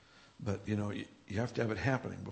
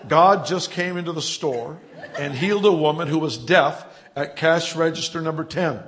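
loud, raised-pitch store announcer; intercom announcement; 4 semitones higher, 11 dB louder than usual. Retold or imitated. Young man announces a healing to shoppers, loud intercom announcement. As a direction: call it out as if over a shop intercom. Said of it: "he got the microphone and he says".

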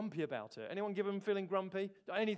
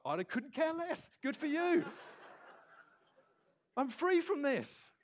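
higher, slow and breezy, a bit smug; smug dismissal; 7 semitones higher, slower than usual. Retold or imitated. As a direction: brush them off happily. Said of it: "I was like".

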